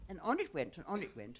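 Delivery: usual speaking voice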